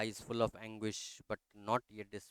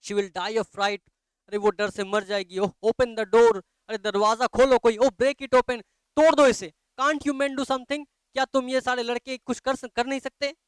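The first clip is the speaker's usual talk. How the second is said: much higher and louder, desperate cries; frantic panic; 10 semitones higher, 11 dB louder than usual. Acted. Mother begging the men to break the vault open, panicked. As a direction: plead in terror for the child.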